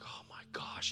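whispered dread; scared whisper. Retold or imitated. Caught boy whispers in dread to his friend. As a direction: breathe it out in fear. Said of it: "We're like".

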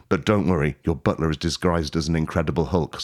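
ordinary talk

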